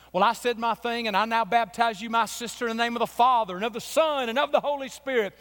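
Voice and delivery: ringing baptismal formula. Retold or imitated.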